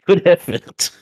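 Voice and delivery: laughing through the words; amused, cracking up. Real, not acted.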